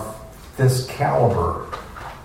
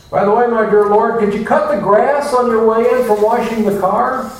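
higher and louder, breezy demands; mock chore prayer; 8 semitones higher, 4 dB louder than usual. Preacher mocking a worshipper ordering God about, higher and louder. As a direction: rattle off chores for the Lord.

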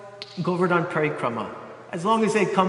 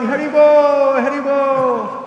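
long, high, loud jubilant shout; joyful cheer; 6 semitones higher, 8 dB louder, slower than usual. Acted. Devotees celebrating the miracle, shouting joyfully. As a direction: cry it out in triumph. Vaishnavas chanting in joy.

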